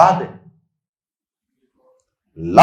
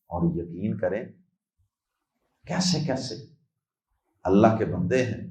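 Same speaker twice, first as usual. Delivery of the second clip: usual speaking voice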